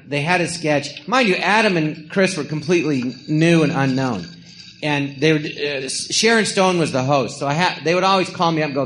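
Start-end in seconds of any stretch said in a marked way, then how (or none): none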